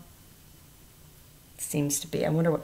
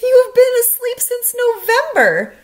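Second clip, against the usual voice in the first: high, loud Frog voice; 10 dB louder than usual. A narrator's reading. Teacher voicing Frog to the class, high and loud. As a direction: pitch it way up and bright for Frog. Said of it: "said Frog".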